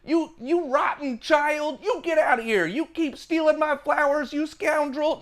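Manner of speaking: put-on high, prim scolding of a naughty child; fussy old lady. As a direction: scold the flower thief in a shrill granny voice. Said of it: "This is not just like".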